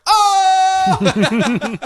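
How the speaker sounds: high, loud amused shout; delighted mock guffaw. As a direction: crow with glee at the scene. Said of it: "laughing to himself like".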